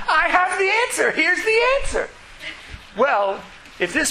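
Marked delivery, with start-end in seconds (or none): 0.1-2.1 smug know-it-all. Pretend. Glib know-it-all quoted.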